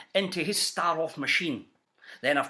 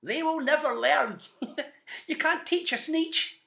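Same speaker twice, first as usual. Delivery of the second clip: higher, mocking laugh through the words; laughing villain; 9 semitones higher than usual. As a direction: cackle as he drives off. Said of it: "he laughed as he drove".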